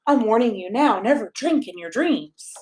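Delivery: ordinary delivery, nothing marked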